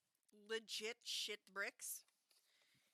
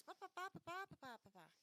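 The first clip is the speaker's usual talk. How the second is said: quick high babble; high babbling filler; 7 semitones higher, 5 dB quieter, faster than usual. Retold or imitated. Mimicking filler pages.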